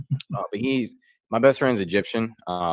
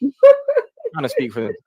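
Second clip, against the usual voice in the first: squeaky aside; 7 dB louder, slower than usual. Pretend. High mock voice aside.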